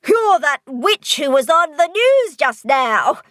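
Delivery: high, slow, loud amazed voice; astonished recognition. Acted. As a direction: drag out the shock of recognition. Monster recognises Polly from news.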